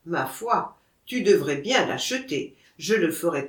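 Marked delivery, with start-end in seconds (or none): none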